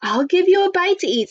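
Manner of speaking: high-pitched storybook woman; giant's wife. Acted. Giant's wife offering Jack food, high character voice.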